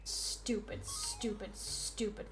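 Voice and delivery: hushed, breathy curses under the breath; whispered muttering. Real, not acted. Gamer cursing the dinosaur to himself, whispered muttering. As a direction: hiss the insults to yourself.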